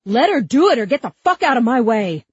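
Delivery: high, loud, frantic ultimatum; furious yell. A narrator's reading. Cop to Huck shielding girl, furious yell. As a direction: keep yelling to the last word.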